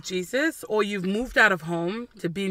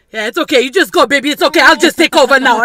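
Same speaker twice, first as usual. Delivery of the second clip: imitating dad taking over; 4 semitones higher, 11 dB louder, faster than usual. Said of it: "It's like".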